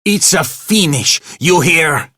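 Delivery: high, loud enraged yell; angry shouting. A narrator's reading. Manager firing the janitor, shouting in rage. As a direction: roar the firing at him.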